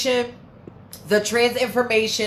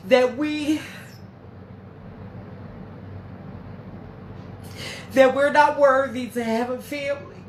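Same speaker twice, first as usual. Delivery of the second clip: crying; 3 semitones higher, 4 dB quieter than usual. Real, not acted. Streamer tearfully praising Lasiah's family, real crying. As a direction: push the words through real tears. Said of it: "damn it, I do not wanna cry".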